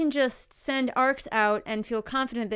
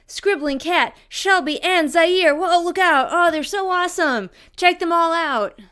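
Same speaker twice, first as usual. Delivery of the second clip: building hype; 5 semitones higher, 7 dB louder than usual. Acted. Building hype to end patrons.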